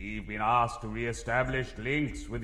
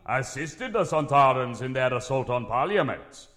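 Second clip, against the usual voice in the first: usual speaking voice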